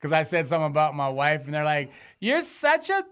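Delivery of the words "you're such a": high put-on voice of an angry woman; mock heckler woman. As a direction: squeal it like the offended heckler. Imitates a woman heckling him.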